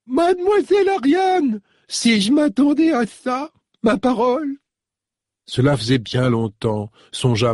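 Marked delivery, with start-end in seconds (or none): 0.1-4.6 put-on very high, cracked old lady voice, delighted surprise; an old servant recognising her young mistress; old woman exclaiming. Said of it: "tout éraillée par la vieillesse".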